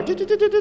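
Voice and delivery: high, repeated do-do-do radar blips; radar beeping. Retold or imitated. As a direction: imitate a scanning radar in a high voice. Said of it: "it's like a radar".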